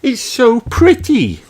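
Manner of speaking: high little princess voice; Holly's delight. Acted. Holly admires the lake bottom. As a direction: marvel like a small girl. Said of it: "cries Holly".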